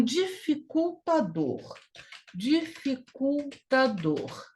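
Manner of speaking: "dificultador" stretched out in separate beats; slow, syllable by syllable. Shown as a demonstration. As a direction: say "dificultador" slowly for note-takers. Teacher spells out a new word.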